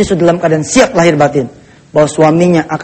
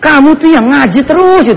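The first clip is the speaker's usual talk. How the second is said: high, drawn-out mock grumble; spouse's nagging complaint; 7 semitones higher than usual. Husband grumbling at his devout wife, high mock nagging. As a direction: complain with stretched words, pitched up.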